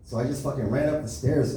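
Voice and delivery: normal talking voice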